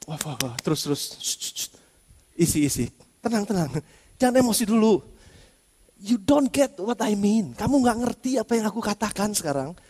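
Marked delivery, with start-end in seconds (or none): 0.1-1.0 much softer, lower and soothing; calming Peter down. Pretend. Jesus soothing an eager Peter, softly and low. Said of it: "ini bahasa saya ya". 1.2-1.7 whispered shushing; soft hushing shh. Pretend. Jesus hushing Peter, a whispered shh. 2.3-7.5 much softer, lower reassurance; calm him down tenderly; soothing. Pretend. Jesus calming Peter, soft and low. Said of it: "ini bahasa saya ya".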